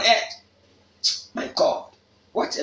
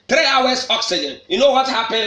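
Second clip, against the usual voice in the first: normal talking voice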